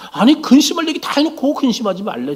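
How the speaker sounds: high-pitched baffled objection; mock disbelief, pitched well up; incredulous protest. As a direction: protest as a bewildered disciple would. Acted disbelief at Jesus' words.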